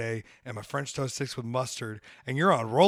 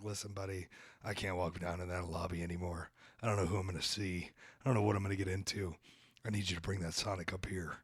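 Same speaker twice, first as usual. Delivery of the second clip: lower, softer hush to the delivery man; low confiding plea; 7 semitones lower, 9 dB quieter than usual. Retold or imitated. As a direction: lean in and level with him.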